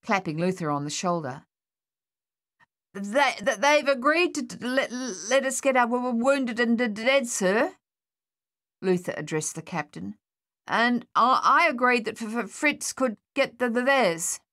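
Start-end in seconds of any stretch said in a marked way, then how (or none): 2.9-7.8 high, stuttering nervous report; nervous private stammering to his captain; stammering soldier. Acted. 10.7-14.4 high, stuttering nervous report; nervous private stammering to his captain; stammering soldier. Acted.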